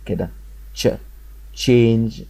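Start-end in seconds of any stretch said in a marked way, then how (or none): 1.5-2.2 "تشينج" said as change, with a clear opening ch; model pronunciation. Shown as a demonstration. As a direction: model "تشينج" for learners, t into sh at the start. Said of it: "لو خدتوا بالكوا بنطقها ازاي".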